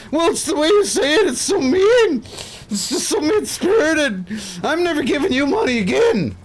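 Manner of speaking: very high, loud whining mock complaint; shrill up a crybaby chatter wailing at the streamer; whiny hurt subscriber.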